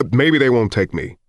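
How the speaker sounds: acted exasperated husband; heated retort. A narrator's reading. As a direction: argue back hard. Husband arguing back in the quarrel.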